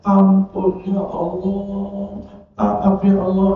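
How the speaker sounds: high, drawn-out, half-sung plea; mock pleading. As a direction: imitate someone begging forgiveness.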